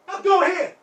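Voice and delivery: loud, high-pitched angry goading; jealous shouting. Acted. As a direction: yell at her to get out there. Jealous man orders her outside.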